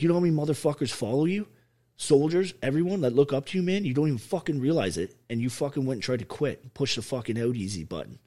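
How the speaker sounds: rally him hard like a sergeant; fired-up pep talk. Retold or imitated. Friend pumping him back up, loud fired-up lecture. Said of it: "He's like".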